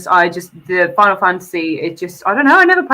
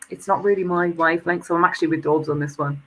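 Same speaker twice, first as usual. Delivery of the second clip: ordinary delivery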